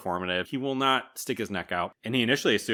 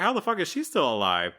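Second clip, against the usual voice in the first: retold disbelief, pitched up high; incredulous; 6 semitones higher than usual. Podcaster recalling his reaction to her age, incredulous. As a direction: recount a baffled, incredulous reaction. Own startled reaction to the film.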